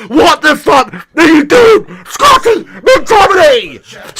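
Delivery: high, loud, slow outburst; exasperated yell. Real, not acted. Fan yelling at Scotty McTominay for the handball, exasperated. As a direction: drag out the name in despair. Fan berating his player.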